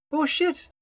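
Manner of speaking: sudden high, loud curse; shocked exclamation. A narrator's reading. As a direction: yelp it out in shock. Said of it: "Almir exclaims".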